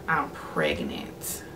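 slow, breathy whisper; whispered confession. Retold or imitated. Telling her boyfriend she is pregnant, whispered slowly. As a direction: barely breathe the words out, slowly.

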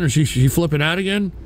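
ordinary talk, nothing marked

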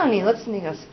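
ordinary delivery

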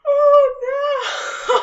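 very high, loud scared shriek into laugh. Real, not acted.

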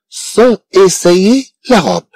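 slow model reading. Shown as a demonstration. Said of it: "teacher models slowly".

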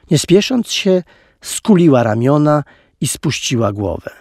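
ordinary delivery, nothing marked

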